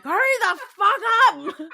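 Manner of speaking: high, loud, drawn-out shout; urgent yell. Retold or imitated. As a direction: yell it urgently at a dawdling colleague. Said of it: "and I'm like".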